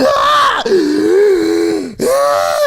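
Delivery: loud, high, sobbing exhale; crying shouted sigh. Retold or imitated. Patient hamming up panic at the doctor. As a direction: wail the breath out in comic distress.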